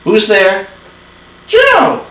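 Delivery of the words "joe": high, whiny puppet voice; stubborn little dummy refusing; squeaky ventriloquist dummy. Acted. Dummy knock-knock joke.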